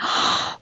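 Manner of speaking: sharp intake of acted surprise; mock starstruck gasp. Airport worker spotting a famous voice actor, imitated gasp.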